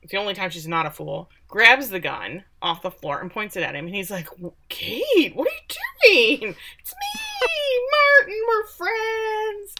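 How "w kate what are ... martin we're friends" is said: very high, drawn-out wailing imitation; hammed-up squeal of a man begging for his life; mock pleading. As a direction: plead in a silly, sky-high, stretched-out voice. Imitating Martin pleading at gunpoint.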